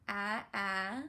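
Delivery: "A, a" slow short a sound. Shown as a demonstration. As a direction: stretch the "a" in "A, a". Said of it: "Let's sound out axe together".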